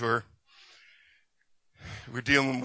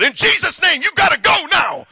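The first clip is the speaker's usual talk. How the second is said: full-force shout, much higher and louder; yelling at the demon; 10 dB louder than usual. Retold or imitated. Pastor yells at the demon to please the girl, full shout. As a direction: roar the command to cast it out. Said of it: "So if I yell at it".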